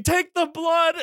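high, strained comic scream; hammed-up frantic demand; retold scream. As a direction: act out a desperate yell without full volume. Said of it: "And I'm, like, screaming".

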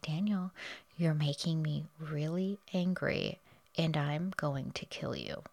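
soft, level voice for a quoted death threat; eerily calm. Retold or imitated. Betty's voicemail threatening Daniel, softly and eerily calm. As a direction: imitate her calm, quiet threat. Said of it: "She is so calm when she says this".

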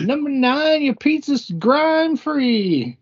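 high, slow rhyming call; order caller. Retold or imitated. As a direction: holler it in rhyme. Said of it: "Or she'd rhyme the food".

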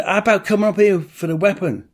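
a pointed rhetorical dig; sarcastic jab. Retold or imitated. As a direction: needle the officers with sarcasm. Arguing with police.

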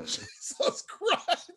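slow, high Jesus Christ through laughter; laughing exasperation. Real, not acted. Friend groaning at a terrible nickname, laughing. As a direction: laugh it out in disbelief.

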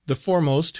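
plain reading voice

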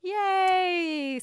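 high, held-out celebratory yay; mock cheer. Host celebrating the end of the film recap, high playful cheer. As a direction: cheer it long and high, lightly hammed up.

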